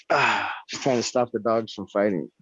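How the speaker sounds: ordinary delivery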